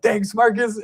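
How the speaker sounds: high, delighted thanks; boy thanking Marcus Allen, thrilled; thrilled kid. Retold or imitated. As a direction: squeak out your thanks like a happy boy. Excited kid thanking star.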